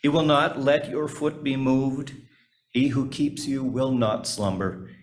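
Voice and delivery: normal talking voice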